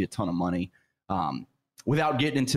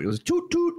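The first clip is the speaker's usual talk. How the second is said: tugboat toot. Retold or imitated. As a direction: honk it like a tugboat. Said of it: "you misunderstood".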